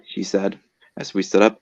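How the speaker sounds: ordinary delivery, nothing marked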